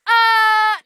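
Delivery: loud, high drawn-out "uh"; objecting birds. Retold or imitated. Birds interrupt the fleeing couple, loud and high. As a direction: squawk a long mock objection.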